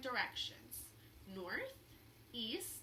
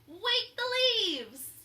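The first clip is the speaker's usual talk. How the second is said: much louder, higher, slow call; stronger call; 10 semitones higher, 10 dB louder, much slower than usual. A narrator's reading. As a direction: call it out with new confidence. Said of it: "Wadney's voice was stronger".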